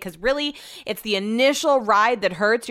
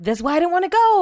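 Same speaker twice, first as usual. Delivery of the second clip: higher and louder, exasperated; annoyed retort; 9 semitones higher, 4 dB louder, faster than usual. Retold or imitated. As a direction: snap back in a replayed argument. Retelling an argument with Brett.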